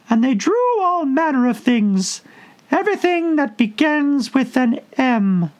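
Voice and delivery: a tiny creature answering after a long think; squeaky Dormouse. A narrator's reading. The sleepy Dormouse goes on.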